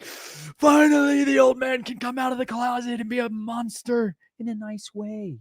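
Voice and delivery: high, slow, playful glee; gleeful self quote. Retold or imitated. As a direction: savour it like a long-awaited release. Gleeful self quote about tradecraft.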